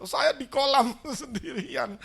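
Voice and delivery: laughing through the words; laughing aside. Retold or imitated. Pastor recalling standing alone in the cold pool, laughing. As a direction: chuckle as you tell it.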